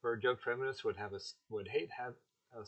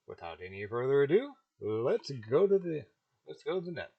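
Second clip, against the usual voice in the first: loud host segue; 4 semitones higher, 11 dB louder than usual. Acted.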